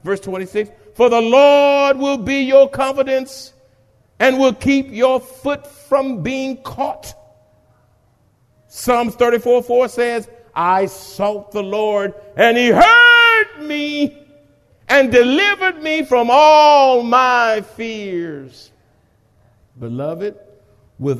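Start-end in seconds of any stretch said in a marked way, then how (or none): 0.9-12.1 impassioned preaching; preaching at full force. Acted. Preached scripture. 12.4-18.7 slow, loud, voice crying; slow tearful cry; tearful preaching. Acted. Emotional scripture climax.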